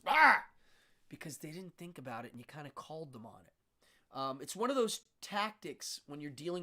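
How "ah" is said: sudden loud high "Ah!"; imitated burst of protest; startled outburst. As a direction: blurt a sharp cry of a stumped believer. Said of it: "the response is".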